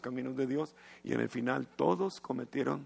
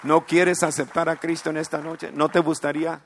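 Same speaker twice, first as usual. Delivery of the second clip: louder, pressing invitation to accept Christ; loud altar call; 3 semitones higher, 9 dB louder than usual. Real, not acted. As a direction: call them forward, urgent and loud. (llamado al altar, fuerte y urgente)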